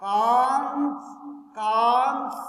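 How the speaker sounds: "Cons" long and loud, each time set apart; syllable by syllable. Shown as a demonstration. Teacher sounding out syllables for students, "cons" stretched loudly. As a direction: draw out "cons" as one stretched beat. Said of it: "Two syllable".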